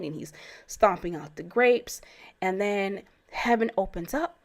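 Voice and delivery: ordinary talk, nothing marked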